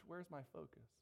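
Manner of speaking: ordinary talk, nothing marked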